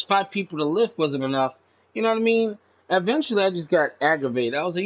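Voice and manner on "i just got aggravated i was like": normal talking voice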